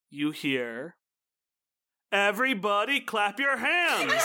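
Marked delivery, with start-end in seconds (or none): 2.1-4.1 high, raised hype-man call; announcer call. Acted. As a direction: belt it out like a dance announcer. Announcement echoing through the hall.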